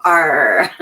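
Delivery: drawn-out pirate "Arr", dropping low; pirate greeting. Retold or imitated. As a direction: growl a pirate hello in passing. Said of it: "dressed like a pirate".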